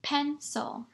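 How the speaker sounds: "Pencil" softly in two beats, PEN, SIL; split into syllables. Shown as a demonstration. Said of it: "I heard two syllables".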